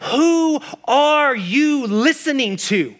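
emphatic preaching question. Acted. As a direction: press each word on the congregation. Preacher drives home God's question.

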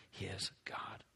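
hushed, breathy whisper; a reverent whisper trailing off. Acted. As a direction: breathe the words out like a secret. Reverent close to the verse.

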